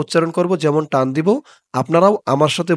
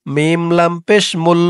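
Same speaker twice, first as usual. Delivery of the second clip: normal talking voice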